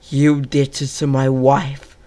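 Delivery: loud, slow disgusted accusation. Acted. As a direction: spit the words at the killer. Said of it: "with disgust in his voice".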